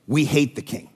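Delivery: ordinary talk, nothing marked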